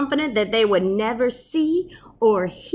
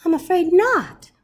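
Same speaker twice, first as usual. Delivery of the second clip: lightly voiced professor; 10 semitones higher, 6 dB louder than usual. Professor replies.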